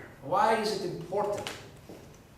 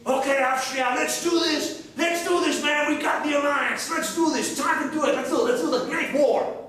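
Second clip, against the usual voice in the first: pumped-up ally; 6 semitones higher than usual. Acted. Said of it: "they tell to their allies".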